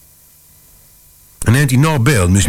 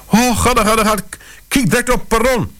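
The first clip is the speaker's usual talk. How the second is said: much higher, breathless frightened urging; mother urging her husband to search the platform; gulping panic. Retold or imitated. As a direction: gasp it out, pushing him to go. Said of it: "gulpte ze tegen mij".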